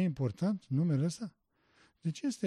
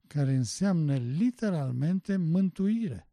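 normal talking voice

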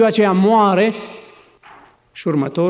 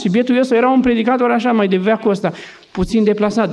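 ordinary talk

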